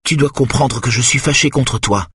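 ordinary delivery, nothing marked

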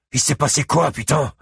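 high, urgent exclamation; crying out. A narrator's reading. Buddy Lee rushing in to ask what happened, crying out. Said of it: "s'écria-t-il".

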